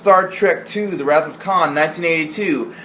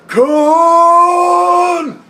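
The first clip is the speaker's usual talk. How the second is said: long, loud, much higher sung-out KHAN held for seconds; drawn-out Khan scream; 9 dB louder than usual. Retold or imitated. Mocking Shatner's famous scream, a huge slow howl. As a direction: wail the name to the heavens, slowly. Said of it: "He's like".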